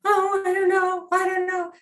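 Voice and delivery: high, unsure and timid; dither, too scared to decide; Weenie Woo voice. Shown as a demonstration. Coach mocks the timid caller, high and unsure. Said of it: "Would you sound like Weenie Woo?".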